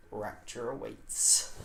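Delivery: slow, dramatic hush; ominous close. Acted. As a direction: let it hang like a threat. Dramatic chapter-ending line.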